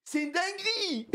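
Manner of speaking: very high, ecstatic shrieking; hyped-up scream with long, stretched words; screaming in disbelief. Real, not acted. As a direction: howl each phrase in amazed joy. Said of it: "Je hurle".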